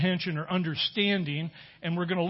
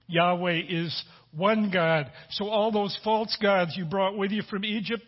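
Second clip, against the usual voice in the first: ordinary delivery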